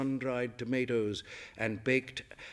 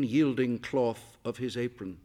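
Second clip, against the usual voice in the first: ordinary delivery, nothing marked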